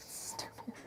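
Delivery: laughing whisper to herself; mocking herself under her breath; whispered self-mock. Real, not acted.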